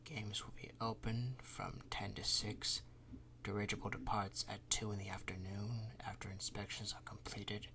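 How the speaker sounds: soft whispered reading of a schedule; reading quietly. Acted. Councilman reading the schedule to himself, whispered. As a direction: read it under your breath. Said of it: "he reads quietly".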